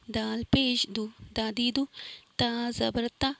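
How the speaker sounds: ordinary talk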